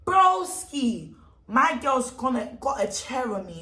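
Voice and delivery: ordinary delivery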